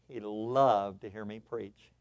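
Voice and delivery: ordinary delivery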